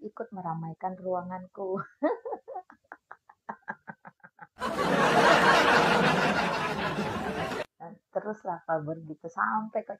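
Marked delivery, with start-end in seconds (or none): 1.8-7.8 long gloating cackle; loud evil cackle. Real, not acted. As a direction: cackle in triumph. Gloating, haters fuel her room. (tawa jahat keras)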